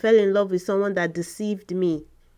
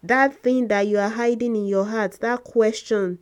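forceful preaching; 2 semitones higher, 6 dB louder than usual. Acted. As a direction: drive it home to the congregation.

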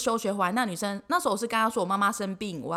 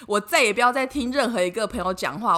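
ordinary talk

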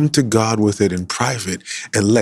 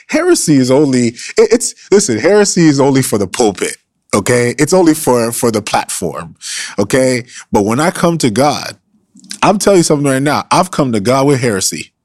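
loud, higher, building sermon cry; preaching at full force; 5 semitones higher, 6 dB louder than usual. Acted. Preacher rises to full force before the church, loud preaching. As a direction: drive the point home to the congregation.

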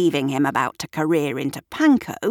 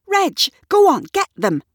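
high, loud egging-on; urging; 13 semitones higher, 6 dB louder than usual. A narrator's reading. Shirley urges Reg at the mice, calling out. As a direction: send the dog after them.

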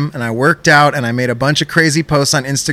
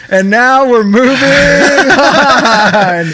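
laughing; 7 semitones higher, 4 dB louder, much slower than usual. Real, not acted. Host laughing at own rant.

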